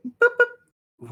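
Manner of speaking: high imitated electronic boops; vocal alarm chime; suit alert beep. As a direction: make two high warning beeps. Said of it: "all of your suits do the".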